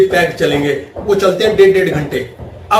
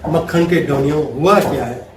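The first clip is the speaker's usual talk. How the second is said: lower, gentle sweet talk; husband sweet-talking his wife, lower and gentle; lovingly; 5 semitones lower, 4 dB quieter than usual. Retold or imitated. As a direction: coax her tenderly. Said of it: "प्यार से पूछता है".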